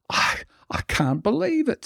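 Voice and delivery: put-on squeaky high amazed drunk; a sozzled man who can't believe his luck; drunk Irishman amazed. Drunk Irishman amazed at a fellow Dubliner, very high.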